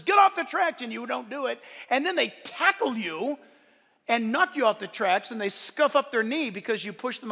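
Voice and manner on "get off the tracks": high, loud imitated shout; retold warning yell. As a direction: yell a warning, as acted in a story. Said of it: "somebody yells and says".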